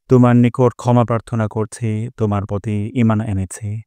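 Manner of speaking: usual speaking voice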